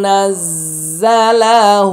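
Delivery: slow, drawn-out chanted recitation; Quran recitation. Shown as a demonstration. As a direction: recite it with long melodic stretch. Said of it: "Quran recitation demo". (ধীরে সুর করে তিলাওয়াত)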